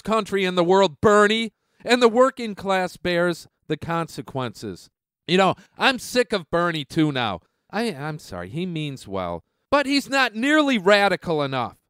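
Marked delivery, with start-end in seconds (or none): none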